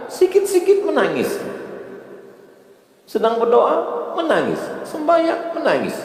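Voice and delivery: high, pleading worried voice; imploring congregant. Retold or imitated. Worried congregant asking the ustaz why he cries, high pleading.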